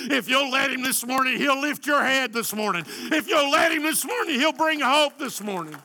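high, loud climactic preaching; preaching at full force. Acted. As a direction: push it up to a shout.

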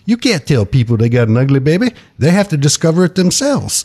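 comically bad Southern accent. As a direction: imitate a friend's funny saying in a hammy Southern accent. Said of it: "do it in my Southern accent like he does".

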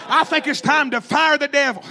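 ordinary talk

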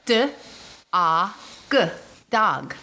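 "dog" sounded out as D-AH-G, then DAHG; dog with an open AH vowel; sounded-out pronunciation. Shown as a demonstration. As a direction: tap out each sound of dog, with an "ah". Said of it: "is how you really say it".

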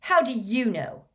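put-on cornered teenager's quick reply; quick retort. A narrator's reading. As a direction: fire it back like a defensive boy. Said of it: "said Malfoy at once".

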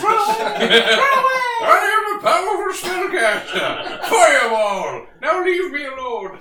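loud, slow, triumphant villain roar; wizard shout. Acted. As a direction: bellow it like a mad wizard. Wizard villain shouts while fleeing.

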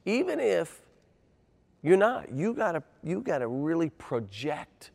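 retold pep talk, pitched high with swooping stresses; imitating a friend's emphatic urging; urgent pep talk.

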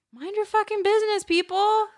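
loud, high-pitched rant; exasperated outburst. Acted. Venting at people who question her work, exasperated outburst. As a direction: cry it out, fed up.

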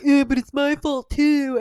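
drawn-out mock protest; slow, lightly put-on martyr voice; Harry Potter. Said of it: "And Harry's like".

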